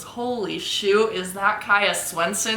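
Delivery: slow, drawn-out astonished line; imitated amazement. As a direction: stretch each word in mock awe. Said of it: "his line for all the years was".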